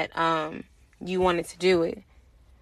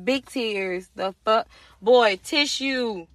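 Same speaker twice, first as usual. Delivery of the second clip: ordinary talk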